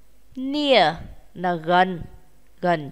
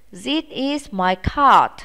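slow, even repeat; slow repeat of the model; slow model sentence; 2 semitones higher, slower than usual. Shown as a demonstration. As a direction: say it again slowly for pupils to copy. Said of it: "Chúng ta cùng nói nhé".

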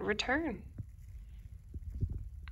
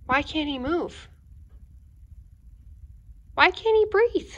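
teacher questioning; 7 semitones higher than usual. Shown as a demonstration. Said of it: "reader asks listeners".

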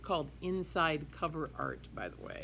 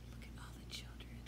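awed whisper; 13 dB quieter, faster than usual. Acted. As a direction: whisper in wonder at the children.

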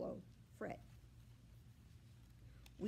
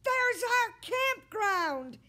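slow, much higher, loud excited yell; 12 dB louder, slower than usual. A narrator's reading. Fred spotting his campground from the boat, loud yell. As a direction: belt it out with joy. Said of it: "Fred yells".

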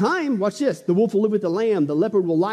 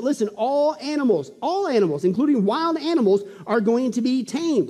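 normal talking voice

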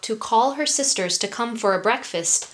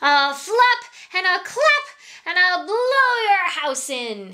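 high, shrieked eagle threat; shrieking eagle; 9 semitones higher than usual. A narrator's reading. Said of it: "The eagle landed and shrieked".

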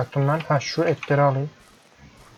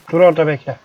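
louder call to stay put; 5 dB louder, faster than usual. Real, not acted. Player shouting at his fleeing goat to stop.

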